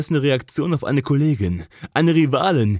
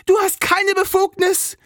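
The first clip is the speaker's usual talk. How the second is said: angry outburst. Acted. Jess to Cole after slamming the folder down, furious shout. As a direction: slam the point home like the folder. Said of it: "knallte die Mappe wütend".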